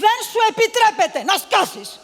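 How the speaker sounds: loud, high yell to shut her up; furious shout. Acted. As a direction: bark it in full rage. Mistress shouts servant down.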